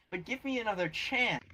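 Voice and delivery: high, stretched-out mock begging; pleading. Fired pizza driver begging his boss, pleading. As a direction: plead with the pitch climbing.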